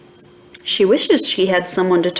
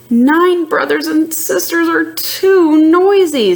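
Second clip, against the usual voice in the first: sighing; 8 semitones higher, 3 dB louder than usual. Acted. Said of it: "sighs Trixie".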